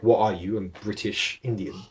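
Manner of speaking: normal talking voice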